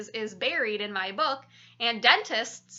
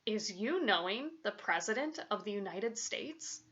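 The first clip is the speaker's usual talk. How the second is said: softer, deferential question; rather respectful; 8 dB quieter than usual. A narrator's reading. Father turns to his son after the call, rather respectful. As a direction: ask it softly, with mild awe. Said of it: "in a rather respectful voice".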